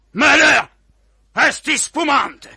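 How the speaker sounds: loud, slow, stunned cry; captain shouting in shock; loud shocked outburst. Acted. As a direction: bellow the discovery. Captain discovers the spy's name.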